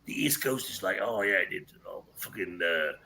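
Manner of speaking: low, muttered take on an American griping; mock American. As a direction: lightly mimic a dismissive American, dropping low and soft. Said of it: "like America would go".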